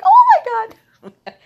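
very high, loud, slow cry of pain half laughing; pained laughing yelp. Retold or imitated. Patient with rods in her leg twisted for an x-ray, high yelping laugh.

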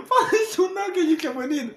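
laughing protest at a dark joke. Real, not acted. (rindo alto)

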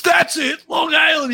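high-pitched mock eureka cry; triumphant retold exclamation. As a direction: imitate a professor pouncing on the answer. Professor guessing his accent, retold.

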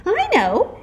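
put-on high, loud eager child; know-it-all exclamation. A narrator's reading. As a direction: burst out with the answer. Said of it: "exclaimed one know-it-all".

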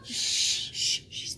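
whispered shushing; hushed whisper. Real, not acted. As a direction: hush someone who is yelling, in a whisper. Hushing the one who yelled.

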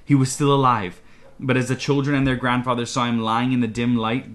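ordinary delivery

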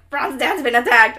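loud, hammed-up cry of alarm; urgent alarm. Harry to McGonagall as she bursts in, urgent shouting. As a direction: blurt the bad news at full volume.

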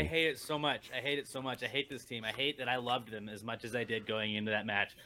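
low-key, quiet bitterness; subdued, dejected venting; bitter despair. Real, not acted. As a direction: mutter it softly, utterly fed up. Despairing over his team.

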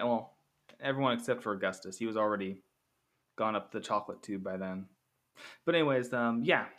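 usual speaking voice

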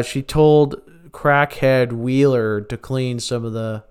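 ordinary delivery

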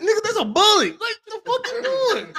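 high, loud, laughing through the words; laughing outburst. Retold or imitated. Father retelling his son's outburst, laughing loud. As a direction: crack up while yelling it.

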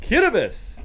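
Kittibus said KIT-ih-bus, with a t sound for the spelt r; Kittibus pronounced. Shown as a demonstration. Host gives the island's spoken name, KIT-ih-bus. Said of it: "as it's pronounced".

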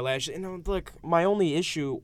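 ordinary delivery

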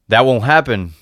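mildly fervent protest. A narrator's reading. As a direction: insist on it fervently. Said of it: "said Alan fervently".

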